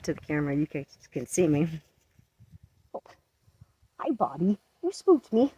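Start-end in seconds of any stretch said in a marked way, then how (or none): 2.9-5.5 startled yelp, pitched up. Real, not acted. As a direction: jump at the sudden fright. Kitten startles her.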